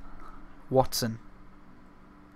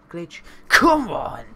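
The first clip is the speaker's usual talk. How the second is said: sudden loud urging yell; excited cheer; 4 semitones higher, 7 dB louder than usual. Real, not acted. Gamer urging his striker, excited loud yell. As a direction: yell at the screen for the shot.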